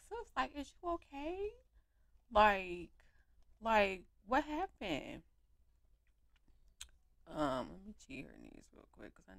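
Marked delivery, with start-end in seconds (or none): 0.1-5.2 caring friend voice. Pretend. Checking on an upset friend, mild caring tone. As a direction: ask gently like a worried friend. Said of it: "check on sis and be like".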